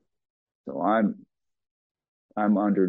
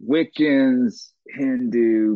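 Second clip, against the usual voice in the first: normal talking voice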